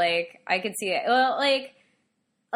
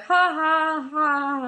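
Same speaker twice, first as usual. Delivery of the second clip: high, spaced-out ha-ha-ha; imitated mocking laughter; 6 semitones higher, 4 dB louder, much slower than usual. Children laughing at the speaker's Spanish, imitated mockingly. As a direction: jeer with fake laughter, high-pitched. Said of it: "and they're like".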